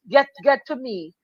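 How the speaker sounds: ordinary talk, nothing marked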